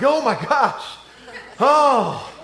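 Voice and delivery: high, loud, hammed-up exhaustion; mock winded exclamation. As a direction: imitate struggling on a run at altitude. Gasping run at altitude, retold.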